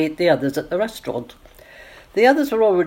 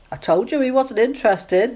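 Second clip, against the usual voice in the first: understated sadness, in a higher voice; sadly; 7 semitones higher than usual. A narrator's reading. Nancy tells Sally he wasn't interested, mildly sad. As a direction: sound a little hurt. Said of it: "Nancy said sadly".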